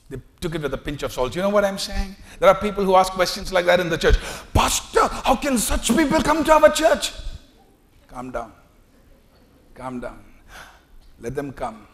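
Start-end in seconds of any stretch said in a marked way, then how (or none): 4.5-7.1 very high whiny complaint; indignant churchgoer. Acted. A churchgoer protesting to the pastor, high and indignant. 8.1-11.9 much softer, lower calming words; soothing pastor. Acted. The pastor calming a complaining member, soft and low.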